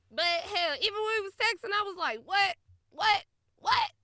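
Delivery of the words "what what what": loud, high repeated "what?"; exaggerated incredulous yelps; mock bafflement. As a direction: fire off each one higher, as if utterly lost. Re-enacts baffled reaction to texts.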